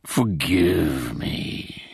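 very slow, drawn-out apology; long mock-mournful wail; ghostly moan. A narrator's reading. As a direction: moan it like a sorry spook. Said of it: "the voice moaned".

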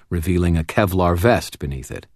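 ordinary delivery

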